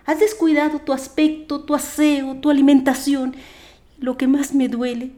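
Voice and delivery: a mother rebuking her son; scolding mother. Acted. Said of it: "escucho su voz increpándome".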